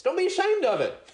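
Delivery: a laugh riding the line. Real, not acted. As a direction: chuckle while urging them on. Preacher laughing as he encourages.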